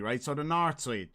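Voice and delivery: ordinary talk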